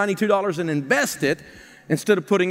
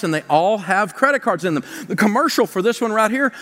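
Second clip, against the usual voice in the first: normal talking voice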